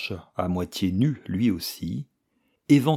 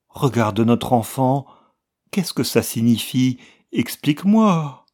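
louder, higher anxious plea; pleading; 5 semitones higher, 6 dB louder than usual. A narrator's reading. Wife pleading with her husband over their child, rising anxious plea. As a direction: beg him to explain, voice rising.

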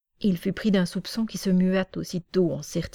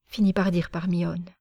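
ordinary delivery, nothing marked

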